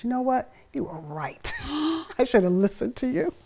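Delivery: high, partly whispered admission; sheepish husband. Retold or imitated. Husband admitting wife was right, sheepish. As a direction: concede it with a laugh. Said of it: "He just looked at me and goes".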